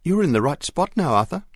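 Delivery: she's so close to getting her ring back; excited. A narrator's reading. Mary calling down that Arthur has found the spot, excited. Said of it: "came Mary's excited voice".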